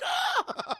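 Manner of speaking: very high mock scream; imitated scream of fright; freaked-out scream. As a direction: squeal like startled kids. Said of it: "They all kinda freak out".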